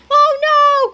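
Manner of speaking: high, very loud alarm; panicked cry. A narrator's reading. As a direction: shriek it like a scared cartoon kid. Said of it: "cried Cheeseball".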